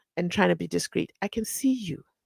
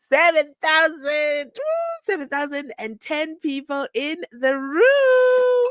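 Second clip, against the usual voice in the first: loud, high, drawn-out cheer; excited celebration; 7 semitones higher, 5 dB louder, slower than usual. Real, not acted. Host celebrates seven thousand viewers, excited. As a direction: whoop it out and stretch the last word.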